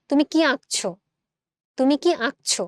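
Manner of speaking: আঁকছো with a nasalised "aa" at the start; nasal vowel. Shown as a demonstration. Teacher asking a sample question, আঁকছো with a nasal first vowel. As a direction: let the first vowel of আঁকছো ring through the nose. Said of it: "তখন কিন্তু আমার নাসিক্য হয়ে আসছে".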